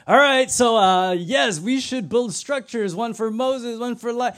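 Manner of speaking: high, loud, gushing ramble; excited babbling. Acted. Peter babbling at God's glory, acted high excited gush. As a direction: blurt plans out in a hammed-up flood. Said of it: "he just starts, like, babbling".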